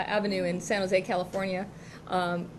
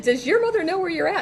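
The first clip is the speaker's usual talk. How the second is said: higher, louder, laughing scold; caught-out neighbor; 9 semitones higher, 7 dB louder than usual. Retold or imitated. Dee Dee catching the child in the adult store, high laughing scold. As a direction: tease the kid while half-laughing.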